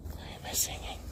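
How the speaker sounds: "Why am I singing?" in a breathy unvoiced whisper. Shown as a demonstration.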